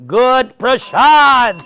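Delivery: loud, high, drawn-out punchline; rousing shout. Real, not acted. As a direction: belt it out to rally the room.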